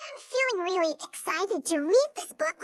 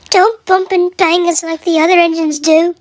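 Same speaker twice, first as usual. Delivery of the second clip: much louder cautioning coaches' voice; loud coaches; 17 dB louder than usual. Acted. Coaches loudly warning Edward as he comes near. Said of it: "said the coaches".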